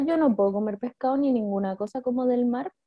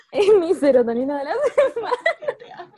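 laughing; 7 semitones higher, 4 dB louder than usual. Real, not acted. Laughs while showing her plate.

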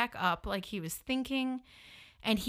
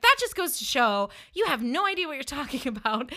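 retold indignant telling-off, higher voice; jumping down his throat; 4 semitones higher, 4 dB louder than usual. Woman berating her date at dinner, indignant. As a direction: tear into him in mock outrage. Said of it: "I literally jumped down his throat".

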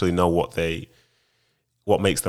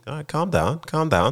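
high-pitched mock plea to settle down; mock calming down; 10 semitones higher than usual. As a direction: pitch it way up, playfully telling someone to ease off. Mock reaction to overexcited newsreaders.

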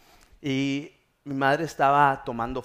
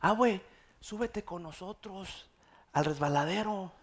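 lightly voiced children; 5 semitones higher, 5 dB quieter than usual. Children inviting grandma onto the slide, lightly acted pleading. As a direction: coax like a child, lightly imitated. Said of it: "mis hijos le dicen".

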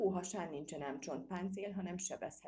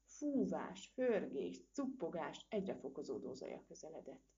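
ordinary delivery

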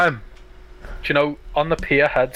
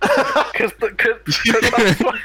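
laughing; 8 semitones higher, much slower than usual. Real, not acted. Laughing while explaining.

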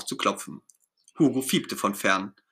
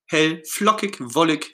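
usual speaking voice

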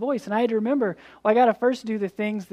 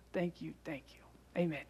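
breathy whispered thanks; whispering; 5 semitones lower, 13 dB quieter than usual. Real, not acted. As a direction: whisper it at the prayer's close. End of prayer, whispered.

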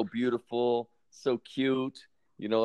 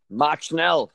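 movie German; 4 semitones higher, 4 dB louder than usual. Retold or imitated. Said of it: "All the German I know is what I learned from Raiders of the Lost Ark".

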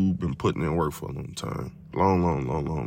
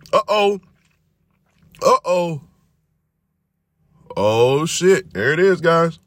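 higher and louder, thrilled it finally works; excited; 12 semitones higher, 6 dB louder than usual. Real, not acted. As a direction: whoop it up now that it kicked in. Vape finally hits, he celebrates.

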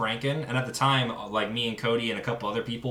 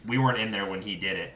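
usual speaking voice

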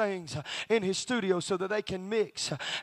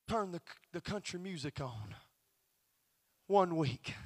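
soft, halting retold confession; subdued confiding; 2 semitones lower, 6 dB quieter than usual. Husband tells pastor where it starts, softly subdued.

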